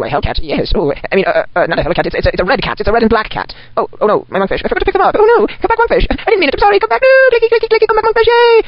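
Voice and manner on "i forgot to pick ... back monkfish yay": squealing after runaway pets, then a relieved yay; high panicked pleading. Acted. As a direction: call the pets back in high-pitched panic. Pets running away, begging them back.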